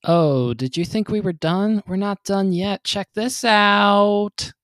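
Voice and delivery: loud, slow hyped announcer. Acted. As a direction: boom it out like a trailer voice. Produced transition voice insert.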